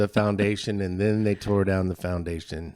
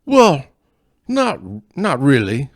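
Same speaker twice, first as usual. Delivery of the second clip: loud, much higher stage Scots-Irish brogue; cartoon Scottish-Irish accent, pitched up and loud; hammed-up Scottish-Irish accent; 6 semitones higher, 9 dB louder than usual. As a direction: play a gruff Scotch-Irish lout, loud and high. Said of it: "I always wanna be good at accents".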